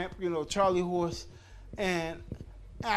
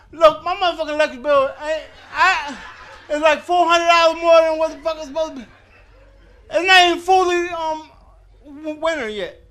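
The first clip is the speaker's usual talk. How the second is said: loud, higher-pitched outraged shouting; indignant rant; 6 semitones higher, 9 dB louder than usual. Acted. Complaining loudly about a high electric bill, indignant shout. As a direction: yell it like the bill is a personal insult.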